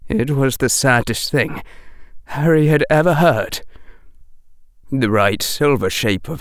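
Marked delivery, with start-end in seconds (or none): none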